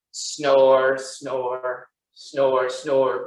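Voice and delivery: low, droning snore words; sleeping bear. A narrator's reading. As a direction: drone it like a slumbering bear. Voices the sleeping bears.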